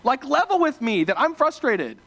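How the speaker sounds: who even are you, disbelieving; incredulous outburst. Retold or imitated. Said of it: "And I'm like".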